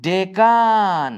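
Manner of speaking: Deccan stretched, de-kan; deliberate, careful Deccan; slow and clear. Shown as a demonstration. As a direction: draw Deccan out for the class. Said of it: "এইটাকে বলবো".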